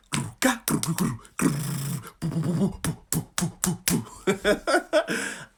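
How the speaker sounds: beatboxed racing heartbeat breaking into laughter; drum-and-bass heartbeat imitation. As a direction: mouth a pounding drum-and-bass beat, then laugh. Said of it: "starts playing drum and bass inside your chest".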